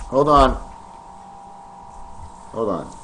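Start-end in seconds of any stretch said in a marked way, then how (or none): 2.5-2.9 much softer and lower, under the breath; quiet mutter. Real, not acted. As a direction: mutter it to himself while fumbling. Fumbling with toys on camera.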